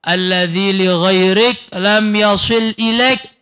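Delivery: slow recited Arabic saying; a slow, solemn recital of Arabic; quoted recitation. Acted. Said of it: "kata Habib Abdul Haddad".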